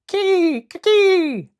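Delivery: very high, loud screech; screeching monkey. Acted. Monkey screeches holding the ship, high shriek.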